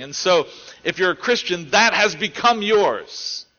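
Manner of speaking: forceful sermon delivery; emphatic preaching. Acted. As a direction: drive each point home from the pulpit.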